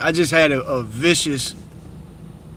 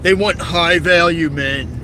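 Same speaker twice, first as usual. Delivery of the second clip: slow, higher, louder mock bro voice; mocking alpha male; 3 semitones higher, 4 dB louder, slower than usual. Vlogger mocking TikTok alpha males, slow exaggerated voice. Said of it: "They be on there".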